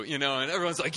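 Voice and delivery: ordinary delivery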